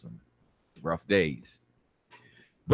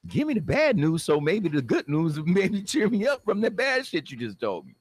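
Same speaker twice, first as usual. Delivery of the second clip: high-pitched, louder exasperation; exasperated retort; 10 semitones higher, 3 dB louder than usual. Acted. Man answering the officer's good-news-bad-news offer, high exasperation. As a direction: push it high in one long rush.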